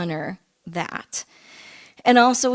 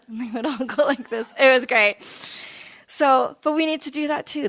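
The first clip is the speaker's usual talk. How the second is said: ordinary talk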